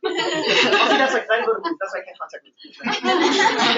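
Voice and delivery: high voice, laughing, mocking a whiny excuse; laughing mockery. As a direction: laugh while parodying the excuse. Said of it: "People always believe".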